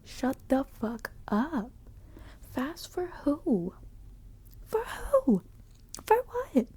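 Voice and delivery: loud, drawn-out exasperated retort; heated comeback. Retold or imitated. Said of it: "Now I'm getting heated".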